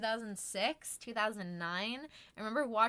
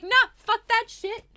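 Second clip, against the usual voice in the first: defiant horse; 11 semitones higher, 12 dB louder than usual. Retold or imitated. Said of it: "He's like".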